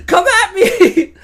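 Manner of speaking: loud, high taunt with a laugh. Acted. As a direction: dare the rats to come, loud and gleeful. Character taunt.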